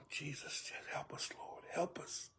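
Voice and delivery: hushed prayer. Real, not acted. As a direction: pray under your breath. Prayer aside in the sermon.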